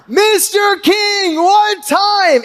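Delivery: high, slow, loud shout. Retold or imitated. Fan waving at Larry King's windshield, high slow shout. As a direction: shout over the engine at the windshield.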